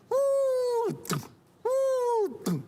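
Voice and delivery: two very high, long whoops; high cartoon wails, one after another; kicked Trids flying. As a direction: falsetto "woo" of tiny creatures booted through the air.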